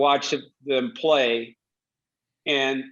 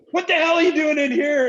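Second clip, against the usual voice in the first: very high, loud imitated coach's scream; Brof's angry yell; 13 semitones higher, 5 dB louder than usual. Coach yelling at player in hot tub.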